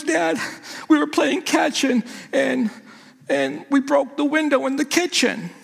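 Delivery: put-on high, small voice of a teary boy; scared son. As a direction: play the frightened boy confessing. Teary son voiced, much higher.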